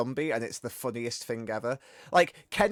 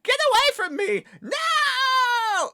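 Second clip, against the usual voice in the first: much higher, louder, crying out in terror; panicked scream; 6 dB louder, much slower than usual. Retold or imitated. Retelling a game cutscene to stream chat, hammed-up panicked shriek. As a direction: shriek like a man about to be eaten. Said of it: "He's just like".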